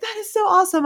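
gushing. Retold or imitated. Her own cheering reply.

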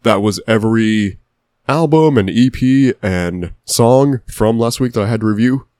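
usual speaking voice

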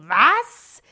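high, loud, rising shriek; hammed-up disbelief; incredulous yelp. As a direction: act out baffled astonishment. Acts shock at distant listener.

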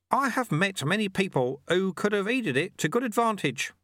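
put-on high, chirpy old man's voice; amused little old man teasing; old sweeper. A narrator's reading. Old sweeper gently mocks the shamed challenger, high and chirpy.

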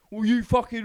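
loud angry biker cutting in; mock shouting biker; retold shout. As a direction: snap it out like the furious biker. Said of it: "shouting at me all day".

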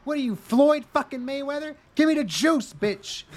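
put-on high, indignant girl voice; hammed-up high girl demanding juice; mock shrill girl. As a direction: squeal it like an outraged girl. Retold girl mocking pharmacist.

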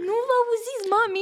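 put-on a mother panicking that her kids can't be heard; imitating mom. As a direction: mimic a worried mother calling out. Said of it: "mama, mesaj".

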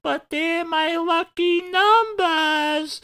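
very high, slow falsetto whine; mock wife voice. Host imitating the hero's wife about her numbers, mock falsetto. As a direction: plead in an exaggerated, loud falsetto.

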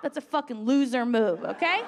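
usual speaking voice

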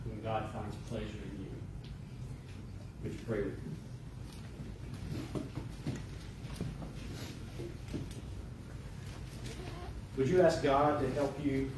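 hushed, measured invitation to prayer; gentle altar call. Acted. Pastor inviting congregation to pray, gentle and hushed. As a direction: ease them softly into prayer, pausing between phrases.

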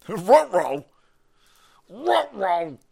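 goofy cartoon dog "uh-oh"; put-on cartoon hound voice. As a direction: mimic a talking cartoon dog in trouble. Cartoon dog catchphrase impression.

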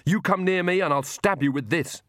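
high-pitched threat, only mildly shouted; understated shout of defiance. A narrator's reading. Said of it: "she shouted".

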